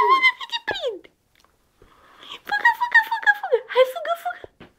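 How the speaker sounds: put-on very high, sing-song baby talk; playful chase squeal. Parent threatening to catch a running child, very high playful squeal. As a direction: squeal it in mock pursuit of a toddler.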